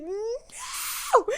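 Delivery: high, loud cry of denial; anguished no. Retold or imitated. Reader reacting to the letter, high anguished cry. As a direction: cry out no as if the page hurt. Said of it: "I was like".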